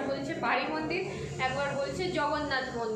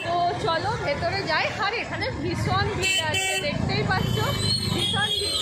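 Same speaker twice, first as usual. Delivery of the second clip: raised, higher voice in a crowd; loud over crowd noise; 5 semitones higher, 5 dB louder than usual. Real, not acted. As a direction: call out over the noisy crowd. (ভিড়ের মধ্যে জোরে বলা)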